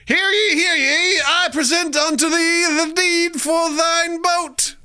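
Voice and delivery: high, loud, slow town crier; herald. Acted. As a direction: proclaim it like a medieval herald. Mock herald proclaiming boat deed.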